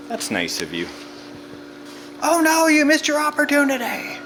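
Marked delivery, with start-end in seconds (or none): 2.2-4.2 excited mock alarm. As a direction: tease the little figure. Mocking the toy figure.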